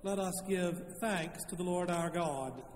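ordinary talk, nothing marked

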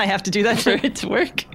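ordinary talk

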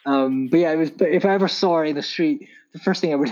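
ordinary talk

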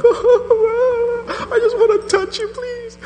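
high, slow, crying and laughing at once; tearful, laughing plea to the holy woman. Retold or imitated. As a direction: beg through laughing tears. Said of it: "and I'm just crying".

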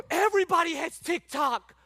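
high, loud gasping protest; gasp, then cry out in mock horror; mock shock. Acts out a shocked objection.

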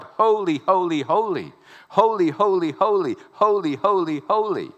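loud ringing holy, over and over; repeated holy cried aloud to the congregation; angels crying. Acted. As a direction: call it out like the angels.

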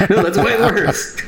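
high, slow words broken by laughter; laughing quote. Retold or imitated. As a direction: laugh through the imagined reply. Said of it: "It's like".

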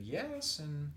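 high-pitched secretary voice; secretary on the phone. Retold or imitated. School secretary answering a job caller, high-pitched imitation. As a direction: put on a high, singsong phone receptionist. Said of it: "She said".

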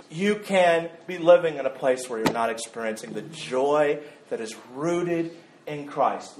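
ordinary talk, nothing marked

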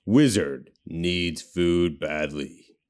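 slow, drawn-out arcade game announcer; Gauntlet Dark Legacy narrator. Retold or imitated. Quoting a game announcer to a co-host, slow imitation narrator voice. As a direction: intone it very slowly, imitating a video game narrator. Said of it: "the guy from Gauntlet Dark Legacy".

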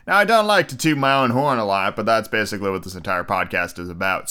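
ordinary delivery

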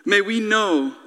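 low, measured closing prayer. Real, not acted. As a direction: pray quietly and earnestly for the congregation.

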